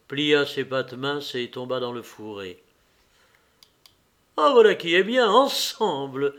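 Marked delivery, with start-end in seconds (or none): 4.4-6.3 a hunter's glad cry at a clean shot; delighted exclamation. A narrator's reading. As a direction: Levin exclaiming in triumph. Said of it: "s'écria Lévin".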